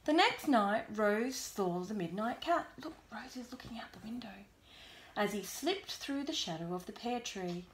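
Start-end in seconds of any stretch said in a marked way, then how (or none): 2.8-4.4 whispered aside. Real, not acted. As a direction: point it out in a whisper. Aside to child while reading.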